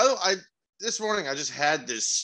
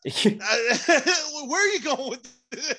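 laughing while asking, pitched up high; 10 semitones higher, 4 dB louder than usual. Real, not acted. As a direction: laugh out the words in disbelief. Laughing at the server story.

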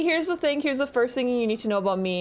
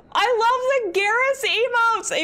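high, drawn-out fan gush; 11 semitones higher, 4 dB louder than usual. Real, not acted. Streamer gushing to chat over Garrus emotes, high and excited.

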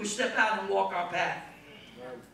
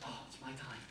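hushed dread; 11 semitones lower, 17 dB quieter than usual. Retold or imitated. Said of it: "And I was like".

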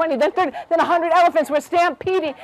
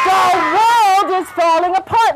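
high, slow, drawn-out cry; screamed alarm; 6 semitones higher, 4 dB louder than usual. A narrator's reading. Rabbits running through the jungle, screamed alarm. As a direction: cry it out in mock panic. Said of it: "running and screaming".

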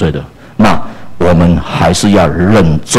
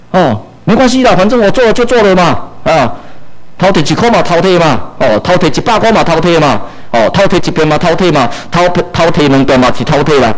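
careless brother; 8 semitones higher than usual. Retold or imitated. Erring brother shrugs off stealing.

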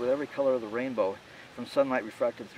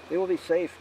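ordinary delivery

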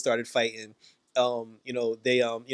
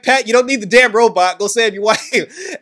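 higher and louder, pushing Pat to go; mock urgent yell; 7 semitones higher, 9 dB louder than usual. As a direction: shout it like a fed-up teammate. Rick urging Pat to go.

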